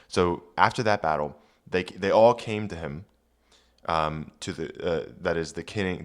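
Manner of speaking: ordinary delivery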